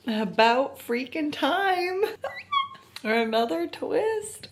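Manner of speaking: slow, high, tearful relief; happy crying. Real, not acted. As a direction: cry with joy that it finally happened. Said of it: "I have a feeling I'm gonna cry".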